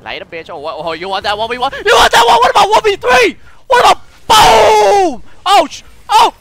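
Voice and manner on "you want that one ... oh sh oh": high, loud taunting shouts; taunting yell. Real, not acted. Gamer taunting three enemies, yelling high and loud. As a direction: yell the taunt mid-fight.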